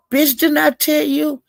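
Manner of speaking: high-pitched, imitated angry comeback; mock angry snap. Gina snapping at him on the panel, high-pitched mock anger. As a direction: fire it back like a sassy retort.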